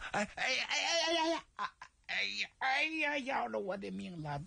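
hammed-up moans of pain, pitch shooting high; mock groaning, clutching his belly; faked stomach pain. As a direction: playacts a cramp to stay the night. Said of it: "双手冷不丁一捂这肚子".

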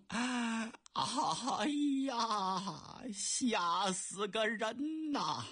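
slow, shaken emperor just coming round; dazed emperor, frightened. Acted. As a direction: drag out the words as if half awake and scared. (杨广惊魂未定，慢慢地说)

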